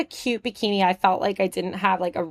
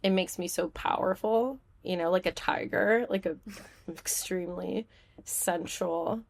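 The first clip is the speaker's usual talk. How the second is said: mock sensual; 8 dB quieter than usual. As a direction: play sultry as a deadpan joke. Joking mock-sensual tiger self-description.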